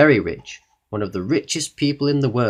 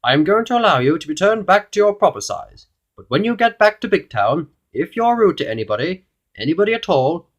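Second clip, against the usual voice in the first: higher, loud royal decree; goblin king; 8 semitones higher, 6 dB louder than usual. A narrator's reading. King setting terms for Mister Uppity, high regal warning. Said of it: "he continued".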